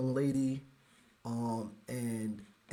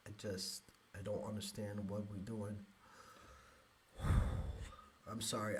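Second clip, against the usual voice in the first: choked up; 5 semitones lower, 10 dB quieter than usual. Real, not acted. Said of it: "I get emotional".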